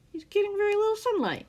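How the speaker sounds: high, fast joke; mocking quip. A narrator's reading. Scar mocking his grouchy captor, high and quick. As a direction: toss off the jibe.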